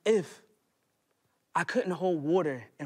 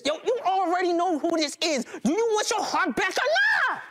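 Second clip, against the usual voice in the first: high, mocking thug on the phone; taunting caller; 12 semitones higher than usual. Robber phoning the narrator about his heart, high mocking taunt. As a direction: taunt him down the line.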